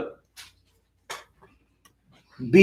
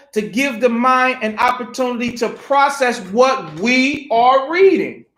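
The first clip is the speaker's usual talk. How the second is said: normal talking voice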